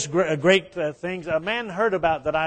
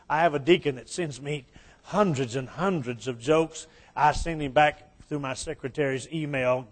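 normal talking voice

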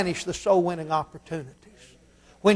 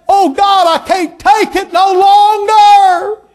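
loud high desperate wail, slowing; crying out to God; 9 semitones higher, 8 dB louder, much slower than usual. Retold or imitated. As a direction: wail it up to heaven. Man cries out to God.

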